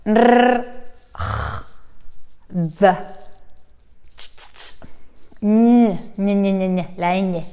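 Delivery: Spanish consonants one by one; typical Spanish sounds. Shown as a demonstration. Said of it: "sonidos muy típicos del español".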